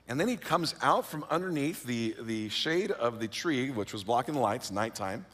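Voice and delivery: ordinary talk, nothing marked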